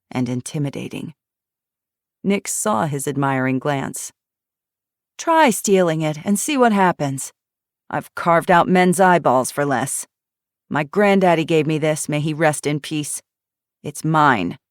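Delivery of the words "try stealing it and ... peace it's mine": loud, menacing warning; guard the gun like a treasure; loud menacing threat over her revolver; fierce threat. Acted.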